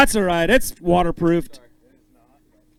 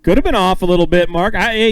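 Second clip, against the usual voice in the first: normal talking voice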